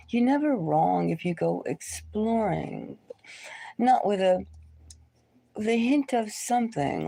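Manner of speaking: normal talking voice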